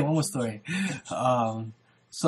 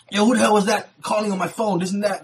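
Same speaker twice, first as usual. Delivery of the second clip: mock angry demand, pitched up; mad; 5 semitones higher than usual. Angry man demands to know who called his phone, mad. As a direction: retell a man confronting someone. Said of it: "this time mad at".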